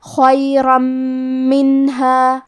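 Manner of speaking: خیرا منہا recited very slowly, the nun merged into a nasal doubled میم; nasal merge. Shown as a demonstration. Teacher demonstrates idgham with ghunna. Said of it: "غنہ اور تشدید کے ساتھ ادا کریں گے".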